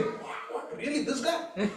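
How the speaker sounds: soft gossiping murmur; hushed astonishment. Acted. Eavesdroppers reacting to a banana seller's hidden wealth, hushed astonishment. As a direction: murmur it like eavesdroppers sharing a secret. Eavesdroppers whispering over a secret.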